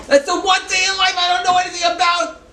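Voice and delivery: emphatic outburst. Real, not acted. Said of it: "emphatic realization".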